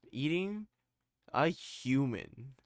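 loud, high, drawn-out mock narration; horror story narrator. Finishing the horror-story impression, hammed-up narrator. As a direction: ham up the grisly reveal slowly and loudly. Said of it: "those horror stories that are like".

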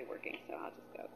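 a soft breathy whisper to herself. Real, not acted. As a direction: breathe it out as a private decision. Private resolve, whispered aside.

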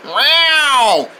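drawn-out cat yowl; cat meow. Acted. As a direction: meow like a cat hiding nearby. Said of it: "anything making a meow sound".

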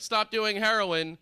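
high, loud and drawn-out plea; urgent mock warning, pitched high and loud; mock intervention shout. Comic giving an example of a wake-up call, mock urgent shout.

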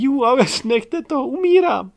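shrieking laugh. Acted. Girl begging friends to stop, shrieking laughter. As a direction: shriek like you're dying laughing.

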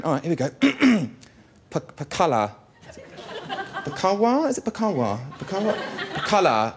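"Pakala" and "Pakawa" tried out hesitantly, sounded out; mum trying Chinese. Retold or imitated. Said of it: "She's there going".

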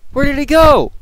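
high, loud, startled; shocked. Real, not acted. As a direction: yelp in disbelief. Shock as enemy vanishes.

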